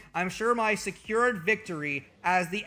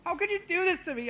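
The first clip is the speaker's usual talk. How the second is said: mock crying; 11 semitones higher, faster than usual. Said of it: "I immediately start crying".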